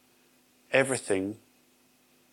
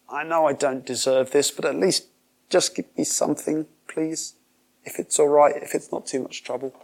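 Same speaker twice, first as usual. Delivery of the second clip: higher, wheedling beggar before God; groveling plea; 3 semitones higher than usual. Pretend. Worshipper grovelling to God for a little mercy, mildly pleading. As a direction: beg for scraps, a lightly voiced timid petitioner.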